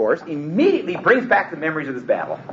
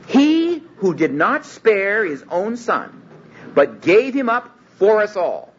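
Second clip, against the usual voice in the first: slow, reverent reading; solemn scripture reading; 5 semitones higher, slower than usual. Acted. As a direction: read it slowly like holy text.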